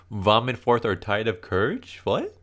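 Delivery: high, questioning repeat in disbelief; puzzled, ending on a high "what?"; incredulous echo. Pretend. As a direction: quote it back as a baffled question. Questions the rousing line back, baffled.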